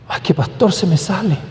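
lightly voiced sheepish excuse; mock excuse. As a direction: imitate a churchgoer making a small excuse.

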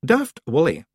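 icy patience. A narrator's reading. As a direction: address the fool with icy patience. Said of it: "with icy patience".

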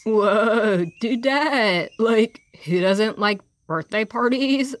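spluttering crocodile. Acted. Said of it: "spluttered Crocodile".

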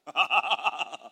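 loud put-on ha-ha-ha; rapid, rolling acted laugh; burst of laughter. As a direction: laugh mockingly at the devil. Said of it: "he burst into laughter".

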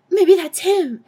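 hammed-up girlish hope; excited hope. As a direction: perk right up, high and eager. Misty hopeful at knock at door.

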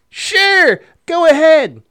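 high, loud, overconfident go-ahead; mock-carefree, punched out; breezy permission. Dad lets the kid remove a life jacket, breezy permission. Acts dad's careless permission to child.

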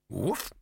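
short rising woof; imitated simple woof; dog bark. A narrator's reading. Chained dog answering his friend, short bark.

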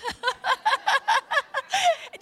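high burst of real laughter; laughing. Real, not acted. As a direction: laugh at your own shout. Laughs at own outburst.

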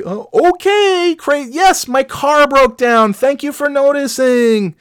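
high, slow, sugary sarcasm; mock sarcasm. Stranded driver thanks the stranger, high sugary sarcasm. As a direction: thank him with fake sweetness.

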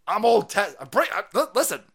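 ordinary talk

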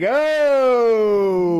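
long, loud, high "oh"; impressed crowd cheer. Acted. Everyone at the meeting reacting to Patty Perfect, impressed. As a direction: draw out a big impressed whoop.

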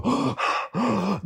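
sharp imitated gasp; mock gasp. Wife reacting to the score, sharp gasp. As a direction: gasp in shock like the watchers. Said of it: "just go".

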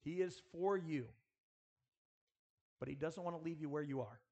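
ordinary talk

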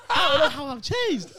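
high, loud words through laughter; laughing. Real, not acted. Reacting to the roommate's complaint, laughing loudly. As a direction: protest while cracking up.

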